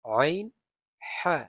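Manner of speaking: loud, guttural আইন and হা; throat letters drill. Shown as a demonstration. Teacher repeating throat letters for students, loud. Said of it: "গলার মধ্যখান থেকে".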